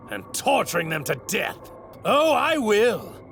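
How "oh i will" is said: high, slow, drawn-out threat; gloating relish. Acted. Villain promising to kill the Saiyan, gloating relish. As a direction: savour each word with villainous glee.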